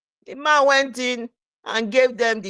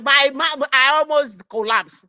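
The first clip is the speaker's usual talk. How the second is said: normal talking voice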